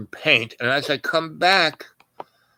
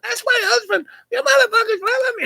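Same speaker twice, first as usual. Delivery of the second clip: much higher mock wife yelling at soldiers; shrill it out like his furious wife. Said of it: "they're screaming at each other".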